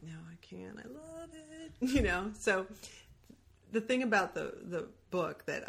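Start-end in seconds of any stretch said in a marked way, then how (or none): 0.0-1.7 a near whisper, soft and pleading; tender protest, "I can't, I love it" barely voiced; hushed refusal. Telling the host she can't punch what she loves, hushed. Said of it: "and I'm like".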